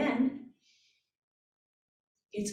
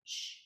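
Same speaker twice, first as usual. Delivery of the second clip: short unvoiced shh, very soft; a breathy little shh to empty the lungs; a hushed diaphragm bounce shh; tiny shush; 13 dB quieter than usual. Shown as a demonstration. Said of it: "And a little".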